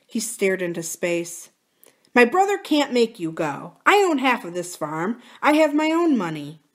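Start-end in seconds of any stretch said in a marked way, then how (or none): 2.1-6.6 higher, firm insistence; lightly voiced defiant husband. Husband defending his wife's right to stay, mildly defiant. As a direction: stand up for her, pitched up.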